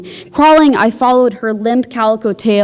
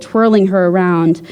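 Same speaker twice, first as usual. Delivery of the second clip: ordinary delivery, nothing marked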